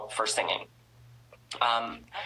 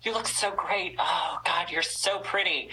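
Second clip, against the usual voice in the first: higher, gushing mock-sweet praise; fake gushing compliment; 4 semitones higher than usual. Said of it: "girls on here that are just like".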